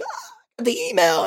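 high, soft mock voice of his girlfriend. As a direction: squeak it like your girlfriend teasing. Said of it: "my chick's like".

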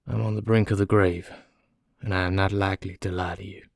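weary but composed confession; placid, resigned prisoner. Acted. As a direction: speak calmly of your coming death.

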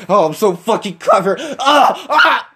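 giggling smug self-praise through laughter; laughing, hammed-up smug boast; laughing mock pride. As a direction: laugh while gloating like the prankster. Mocking Gia's pride.

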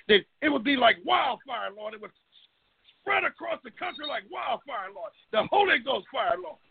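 usual speaking voice

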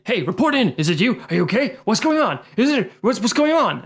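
high, mock-worried radio check; urgent calling. Host voices agents calling their silent colleague, urgent.

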